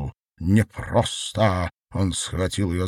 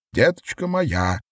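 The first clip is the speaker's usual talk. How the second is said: put-on higher-pitched cry to a child; anguished endearment; 6 semitones higher than usual. A narrator's reading. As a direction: coo it out in distress. Mother watching her daughter.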